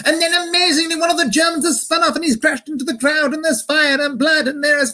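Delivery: very high-pitched, running posh commentary; underplaying British commentator. Commentator describing crash into crowd, absurdly high British commentary. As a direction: keep commentating in a high mock-newsreel voice. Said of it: "underplaying things".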